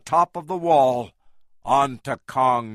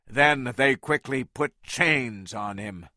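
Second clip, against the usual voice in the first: usual speaking voice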